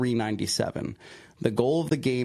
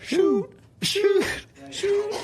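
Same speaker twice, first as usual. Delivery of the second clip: high squeal; 3 dB louder, much slower than usual. Acted. Comic rock paper scissors call.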